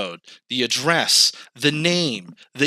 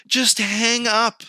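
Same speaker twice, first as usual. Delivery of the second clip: exasperated; 7 semitones higher than usual. Retold or imitated. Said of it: "And I'm like".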